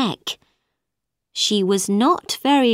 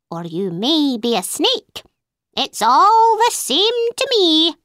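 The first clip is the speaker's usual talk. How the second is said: higher, knowing bird, rising at the end; a sly bird that doesn't care; 6 semitones higher than usual. A narrator's reading. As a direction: bird telling Alice it sees through her. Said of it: "Then the bird said".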